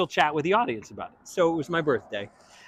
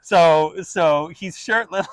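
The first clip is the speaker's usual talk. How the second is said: higher, louder, amused chatter to the crowd; teasing Adina; 6 semitones higher, 6 dB louder than usual. Retold or imitated. Adina teasing her co-star to the audience, high and amused. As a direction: tease him to the audience, giggly and high. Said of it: "looks at the audience and goes".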